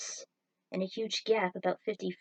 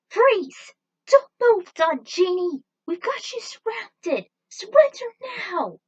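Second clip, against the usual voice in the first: loud, high commanding shout; 13 semitones higher, 12 dB louder, slower than usual. A narrator's reading. Tinsel holding her ice glock on Don Genie, shouting. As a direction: bark the order to freeze at the villain. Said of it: "she shouted".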